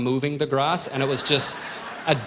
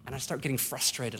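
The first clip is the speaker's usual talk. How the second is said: ordinary talk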